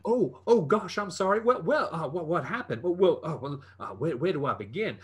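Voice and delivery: high, stammering apology; lightly voiced nervous stammer; flustered prisoner. NPC flustered after being hushed.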